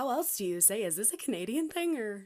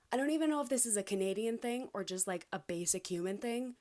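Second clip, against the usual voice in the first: ordinary talk, nothing marked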